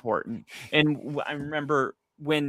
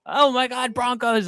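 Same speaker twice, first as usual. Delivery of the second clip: higher, gushing fan squeal; fan excitement; 8 semitones higher than usual. Retold or imitated. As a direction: gush like a superfan. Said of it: "full just spectrum me".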